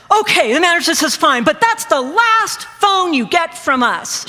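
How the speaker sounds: high, emphatic finger-wagging reprimand; scolding warning. Retold or imitated. Clerk giving a customer his last phone, scolding and emphatic.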